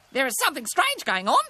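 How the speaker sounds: high, loud bird detective; high-pitched, determined magpie; magpie character. A narrator's reading. Voices the magpie.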